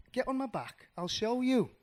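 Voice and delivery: high, inviting bird voice; eager little bluebird. Acted. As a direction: offer it high and encouragingly. Bluebird invites mouse to fly.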